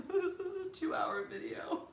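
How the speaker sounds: high, slow, through real laughter; amused realization. Real, not acted. Realizing how long the recording is, laughing.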